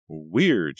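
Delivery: plain reading voice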